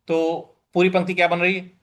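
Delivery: ordinary talk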